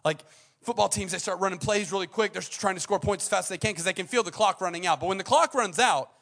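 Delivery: loud, high, impassioned preaching; preaching at full force. Acted. Preacher urging the congregation, loud and impassioned. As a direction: drive the point home to the crowd.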